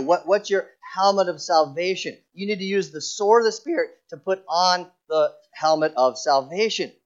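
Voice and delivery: normal talking voice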